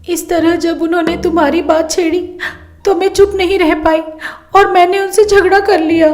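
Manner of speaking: high-pitched tearful complaint; acted sobbing daughter's lament; crying. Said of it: "रोते रोते बोलती है".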